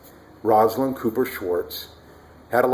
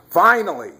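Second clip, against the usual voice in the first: high, loud relieved shout; loud exclamation; 8 semitones higher, 6 dB louder than usual. Retold or imitated. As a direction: blurt it out over the sleeping baby.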